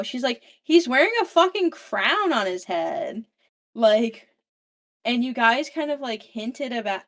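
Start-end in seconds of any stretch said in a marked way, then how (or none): 0.6-3.2 louder, higher, duh tone; exasperated retort. Retold or imitated. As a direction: scoff that it was obvious. Evie exasperated.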